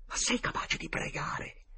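slow, high, strained whisper; frightened whisper. Acted. Boy asking his friend to pray, whispering in fear. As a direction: whisper it in helpless dread. Terrified boy asks for a prayer.

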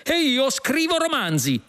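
high-pitched outburst from the other cop; exclaiming. A narrator's reading. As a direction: blurt it proudly, high. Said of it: "esclamò l'altro poliziotto".

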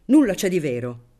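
loud and clipped refusal; curt. Acted. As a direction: cut him off firmly. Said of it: "rispose recisamente".

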